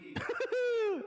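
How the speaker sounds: high, scornful cackle; a shrill mocking burst of laughter. Real, not acted. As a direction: cackle at them in contempt. Mocking the audience.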